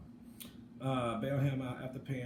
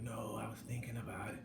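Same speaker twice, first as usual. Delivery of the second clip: hushed whispered quote; 4 semitones lower, 10 dB quieter than usual. Retold or imitated. Friend answering about R. Kelly, hushed whisper. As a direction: whisper it like a secret. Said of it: "a friend of mine was like".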